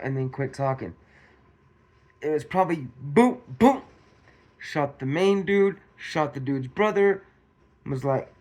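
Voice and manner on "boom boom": loud rising boom boom blasts, the second higher; imitated gunshots. Guessing how the shooter fired, loud booming gunshot sounds. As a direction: fire two loud booms. Mimics the double shot.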